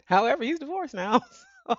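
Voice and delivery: giggling at the irony; laughing. Real, not acted. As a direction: laugh it out, amused.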